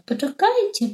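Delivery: a high call out the window. Acted. Stork shouts after the departing flock, high call. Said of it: "zaczął wołać".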